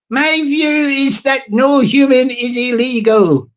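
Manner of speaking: slow put-on reading voice; Stevie Boy. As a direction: read it out slowly in a mock voice. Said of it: "Stevie Boy don't sound like that".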